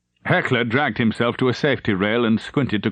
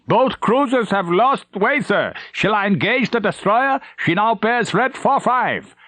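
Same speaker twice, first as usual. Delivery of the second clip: intercom report; 11 semitones higher than usual. Acted. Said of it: "intercom croaked".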